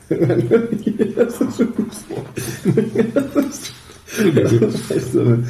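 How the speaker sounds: laughing through the words. Real, not acted. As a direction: giggle while speaking. Laughing at partner.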